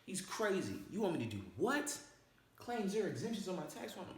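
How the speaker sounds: mock disbelief at the idea; incredulous viewer. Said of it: "I already know what you're thinking".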